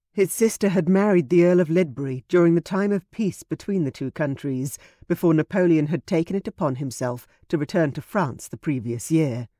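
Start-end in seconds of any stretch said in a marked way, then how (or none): none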